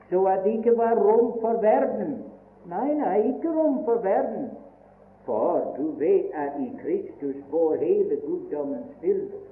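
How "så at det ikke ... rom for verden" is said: higher and louder, driving the point home; fervent preaching. Real, not acted. Preacher to the congregation, fervent and raised. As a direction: build to a ringing no room for the world.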